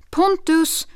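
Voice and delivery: loud high call across the street. A narrator's reading. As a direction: call him back from the street. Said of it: "ropade hon". (ropar högt efter honom)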